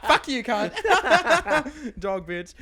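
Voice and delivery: shrieking with laughter, high and loud; playful insult through loud laughter; laughing mock insult. Real, not acted. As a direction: cackle the insult out between fits of laughter.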